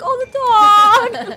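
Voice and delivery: high, slow, near-crying wail; mock heartbreak. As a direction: grieve for the dog, hammed-up. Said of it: "and they're like".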